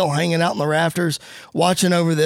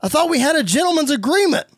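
high, heated confrontation; indignant wrestler, pitch raised; acted indignant yell; 9 semitones higher than usual. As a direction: yell after him, demanding an explanation. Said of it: "and he yells".

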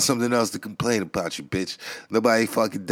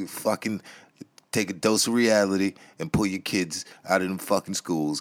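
normal talking voice

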